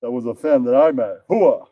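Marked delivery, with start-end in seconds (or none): 1.3-1.7 Al Pacino. Acted. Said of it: "Al Pacino".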